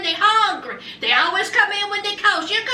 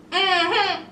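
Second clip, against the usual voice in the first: ordinary talk, nothing marked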